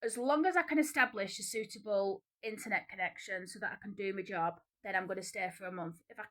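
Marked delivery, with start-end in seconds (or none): none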